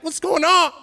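high-pitched playful disbelief; mock bafflement. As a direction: exclaim it in mock confusion. Acting out a baffled returning gamer.

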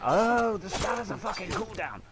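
loud high angry yell; outraged shout. Real, not acted. Gamer furious at cooldown, loud outraged shout. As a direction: blurt it in outrage.

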